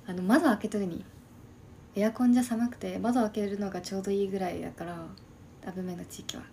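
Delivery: normal talking voice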